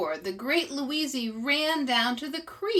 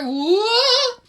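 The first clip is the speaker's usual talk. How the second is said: high loud startled whoa; 13 semitones higher, 11 dB louder than usual. Acted. Arnold losing his other foot, loud high yelp. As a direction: bigger yelp as he slips.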